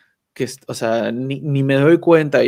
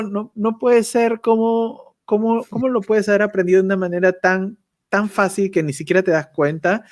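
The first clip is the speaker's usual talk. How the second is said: higher, half-mock astonishment; amazed disbelief; 6 semitones higher than usual. Reacting to the effortless German learner, in higher mock disbelief. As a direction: act amazed it came so easily. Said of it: "Y yo digo".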